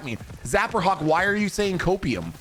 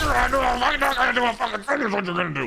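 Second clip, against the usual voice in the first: mock tough taunt; 6 semitones higher, faster than usual. Taunting enemy in game.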